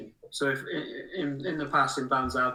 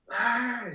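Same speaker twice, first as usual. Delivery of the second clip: high "ah" of a crowd; raised, playful cheer; mock crowd cheer; 11 semitones higher, 4 dB louder than usual. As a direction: imitate fans cheering, pitched up. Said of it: "And they're like".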